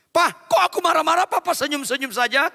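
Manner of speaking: high, loud imitation of a complaining wife; wife scolding her smiling husband, hammed-up high; mock wife. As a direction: squeal it like the annoyed wife.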